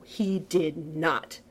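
very slow, weighty denial; ominous emphasis. A narrator's reading. Narrator promising revenge on Clint, ominous. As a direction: lean hard on each word.

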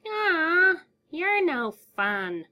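very high, slow, sulky whine; whiny clown. A narrator's reading. The clown sulks at the creature's refusal, whining. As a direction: pout like a spoiled child. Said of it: "the clown answered".

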